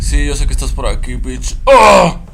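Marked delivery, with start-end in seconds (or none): 1.6-2.2 short, high, loud yelp; sudden real cry of fright; startled scream. Real, not acted. Player meets the creature, startled scream. Jump scare in horror game.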